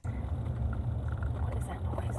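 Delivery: whispered, puzzled question. Real, not acted. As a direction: hush and listen. Hears a strange noise.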